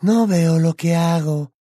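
put-on high, slow girl's voice; Luna. A narrator's reading. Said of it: "susurró Luna".

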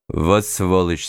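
put-on slow, muttered line in a Georgian accent. A narrator's reading. Georgian bystander behind the narrator, slow Georgian accent. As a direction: voice it lightly as a Georgian man. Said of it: "голос с грузинским акцентом".

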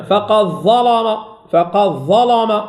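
slow chant, heavy L and back A in "ظلم"; heavy lam in recitation. Shown as a demonstration. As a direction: chant "ظلم" slowly with a dark L. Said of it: "إذا تفخم".

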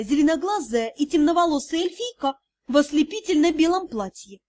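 usual speaking voice

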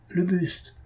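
first startled call, caught in the throat; startled cry. A narrator's reading. A student spotting the green bust, startled half-cry. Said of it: "une voix s'écria".

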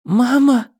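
put-on high-pitched girlish call; high, pleading child's cry; girl calling mother. A narrator's reading. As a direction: call out for mama, voice lifted high. Daughter calls out to her mother.

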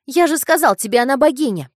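put-on high, loud boyish shout. A narrator's reading. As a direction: yell it back defiantly. Boy shouts back.

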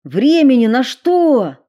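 loud high snapped question; sharp demand. Acted. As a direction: bite out the question. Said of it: "резко спросил он".

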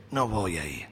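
a discouraged believer turning the pastor down; dejected refusal. Retold or imitated. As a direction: say it flat out of discouragement.

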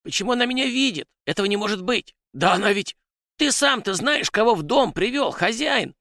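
very high, agitated creature voice; panicked house spirit. A narrator's reading. House spirit berates his master over the guest, high-pitched panic. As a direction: squeal in flustered alarm at being found out.

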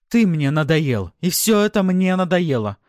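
high, loud, fed-up cry; furious outburst. A narrator's reading. Wife sick of her marriage, furious. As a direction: shout your frustration at him. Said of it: "воскликнула женщина".

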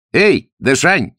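high, loud, drawn-out hail; taunting shout. A narrator's reading. The pursuers' leader hails Zagorsky, shouting tauntingly. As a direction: holler slowly to provoke the enemy. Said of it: "крикнул он по-тибетски".

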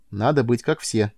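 plain reading voice

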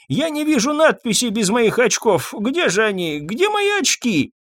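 put-on high, querulous elderly voice; doddering complaint rising to a high wail; fretful old man. A narrator's reading. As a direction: fret aloud in a high old voice. Old pharmacist hunts for his glasses.

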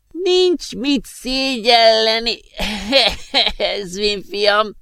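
magas, lassú, recsegő öreg hang; krákogva vigasztalja Ezvint; magas krákogás. Acted. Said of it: "krákogta magas hangon a professzor". (magas hangon krákogó öreg professzor)

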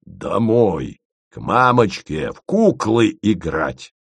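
a fierce, slowing bark of an order; ferocious growl, the last words drawn out slow. A narrator's reading. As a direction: growl her out of the room. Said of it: "свирепо зарычал".

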